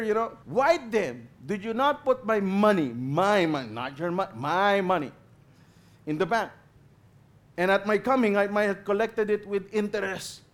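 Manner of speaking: loud, high, furious master condemning the servant; stern master. Acted. Master condemning the lazy servant, loud and furious. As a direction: thunder the master's verdict, word by word.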